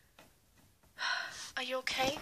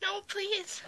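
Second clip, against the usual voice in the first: pleading; 7 semitones higher, 6 dB quieter than usual. Acted. Victim begs.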